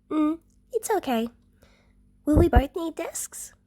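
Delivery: put-on high small child voice; a young girl considering; little girl Eri. Eri asks June about desks in a high child voice.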